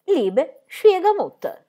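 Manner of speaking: high, slow, careful recitation; coaxing singled-out words, pitched well up; helpless repeating. A narrator's reading. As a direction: recite the one memorized German phrase. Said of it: "Non riusciva a ricordare una singola parola".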